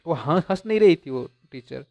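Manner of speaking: usual speaking voice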